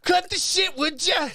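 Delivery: high, loud hammed-up Scottish shout; mock Scottish accent. Co-host mocking the host's accent, high shouted Scottish. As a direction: squawk it in exaggerated Scottish. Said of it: "I was doing you doing the accent".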